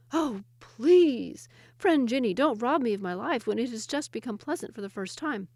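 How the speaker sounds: high, pleading plea; begging Ahmad. Acted. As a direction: beg for your life. Ahmad begs the jinni.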